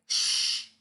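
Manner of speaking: quick hushing shh; shushing. Acted. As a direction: hush the room with a shh. Hushing the monkeys.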